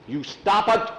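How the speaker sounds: high, loud villain command; angry yell. Acted. Skull yelling at the boys and Thor, angry. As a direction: yell to shut them up. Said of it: "he's yelling at you again".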